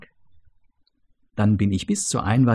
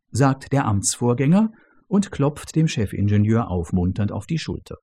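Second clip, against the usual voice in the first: plain reading voice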